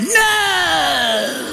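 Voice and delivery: long, high mock wail; upset kid's wail. Boy denying a fight to mom, high wail. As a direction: stretch it into a childish howl.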